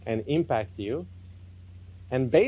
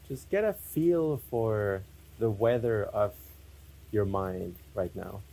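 spacious, unhurried meditation guidance; guided meditation; 4 dB quieter than usual. Real, not acted. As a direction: lead them inward unhurriedly, phrase by phrase.

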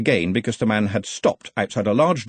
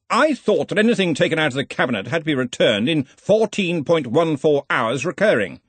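higher-voiced man in charge, querying; lightly voiced authoritative wizard; brisk Arch-Chancellor; 8 semitones higher than usual. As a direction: query it as the head of the university. Ridcully questions the rule.

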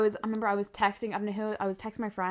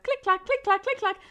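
high clipped click-clack of running heels; imitated heel clicks; 12 semitones higher, 5 dB louder than usual. Mother hears daughter mimic the guard's running heels. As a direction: mimic heels chasing you down. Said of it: "like, footsteps".

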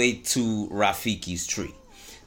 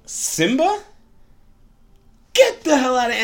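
Nala's shock; 10 semitones higher, 4 dB louder than usual. Retold or imitated. Nala in disbelief.

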